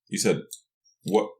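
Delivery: ordinary delivery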